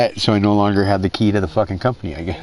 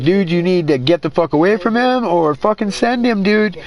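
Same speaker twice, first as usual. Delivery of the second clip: exasperated advice; 8 semitones higher than usual. Retold or imitated. Warning his buddy about Dino, exasperated and raised. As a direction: replay telling him to get away. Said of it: "I'm like".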